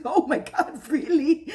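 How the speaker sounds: high, loud, laughing gush; delighted squeal. Retold or imitated. Miranda thrilled to be invited, laughing high squeal. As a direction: squeal it like the best news ever. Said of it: "She goes".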